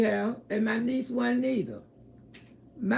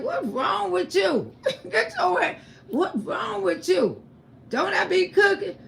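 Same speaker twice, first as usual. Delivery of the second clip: laughing retort; 7 semitones higher than usual. Retold or imitated. Mother laughing off her son's fuss, high and amused. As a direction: brush him off with a laugh.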